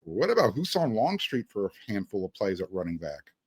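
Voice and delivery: ordinary talk, nothing marked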